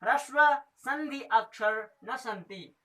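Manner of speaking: slow chanted Sanskrit rule; sutra recitation. Shown as a demonstration. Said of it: "तो ऋषि ने कहा". (धीमा सूत्र पाठ)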